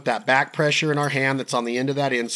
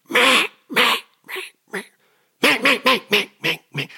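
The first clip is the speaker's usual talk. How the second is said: high tight nasal duck quack; squeezed pressurized quack calls; hen mallard quack; 11 semitones higher, 3 dB louder than usual. Shown as a demonstration. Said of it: "and it's".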